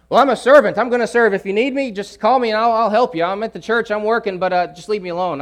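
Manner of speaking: mock the church worker who wants to be left alone; busy churchgoer. Preacher mocking a busy church worker, loud and dismissive. Church people hiding behind giftedness.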